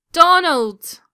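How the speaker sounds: loud, high yell of his name; furious yell at her brother; angry shout. Acted. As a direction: shout at the brother who threw it. Hit by a water balloon.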